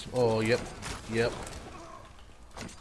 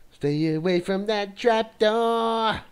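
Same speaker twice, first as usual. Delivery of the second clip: spooky warning voice; 3 semitones higher, 4 dB louder than usual. Pretend. Spooky warning about trapdoor.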